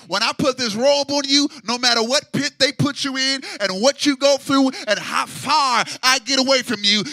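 loud, rising sermon build; preaching at full force. Acted. Preacher voicing Joseph's father to the church, building loud. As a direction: build to a shout on the last words.

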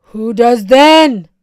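loud, challenging demand; defiant. Acted. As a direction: throw the question back in defiance. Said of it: "they asked defiantly".

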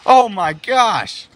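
high, loud, drawn-out exclamations; excited play-by-play. Acted. Commentator reacts to kick and punch, loud amazement. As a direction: punch out each hit for the crowd.

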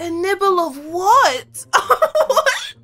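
high-pitched, laughing disbelief; squeaky, giggling astonishment; incredulous laughing. Real, not acted. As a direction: crack up while asking in shock. Shocked laughter at flirty phone call.

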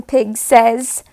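ordinary delivery

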